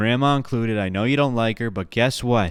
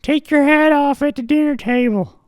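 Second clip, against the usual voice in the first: put-on high, old grandma voice, judging; squeaky granny nagging her grandson. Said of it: "And she goes".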